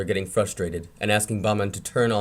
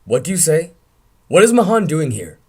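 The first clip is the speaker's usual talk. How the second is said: louder, higher alarmed uncle; alarmed shout; 6 semitones higher, 8 dB louder than usual. Acted. Uncle hearing of the accident, alarmed shout. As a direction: shout it out in shock.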